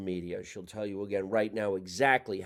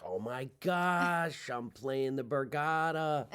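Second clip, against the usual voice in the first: high, drawn-out gushing; acted thrilled delight; 5 semitones higher, slower than usual. Comic reliving first Borgata booking, acted thrilled delight. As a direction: stretch it out in giddy, higher-pitched wonder.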